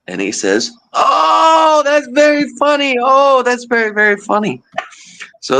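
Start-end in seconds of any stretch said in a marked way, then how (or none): 0.9-4.6 very high, delighted mock Guatemalan accent; imitated Guatemalan accent. Guatemalan coworker just learning the word's meaning, delighted imitated accent. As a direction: squeal it in a put-on Guatemalan accent, easing down. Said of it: "he had such a heavy accent".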